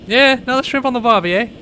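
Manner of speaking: high, hammed-up Aussie voice; playful Australian accent; mock Aussie accent. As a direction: ham up an Aussie barbie cliché. Echoing mock Aussie line.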